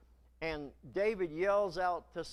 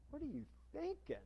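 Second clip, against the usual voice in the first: high, soft, fast hush; horrified aside; 8 semitones higher, 9 dB quieter, faster than usual. Acted. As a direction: hiss it in disbelief. Follower's aside in horror.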